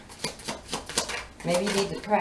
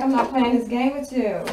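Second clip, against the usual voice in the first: higher, louder quoted refusal; mock indignation; 5 semitones higher, 6 dB louder than usual. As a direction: act out her fed-up protest. Quoted femme refusing to play along.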